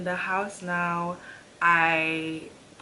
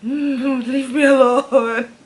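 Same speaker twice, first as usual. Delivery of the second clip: groggy whine; 6 semitones higher, 11 dB louder, slower than usual. Retold or imitated. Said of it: "I'm like".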